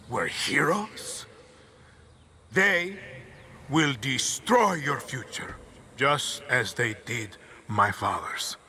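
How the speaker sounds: dictator rallying the nation, building from whisper to shout; rousing political speech. Acted. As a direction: orate like a tyrant swaying a crowd.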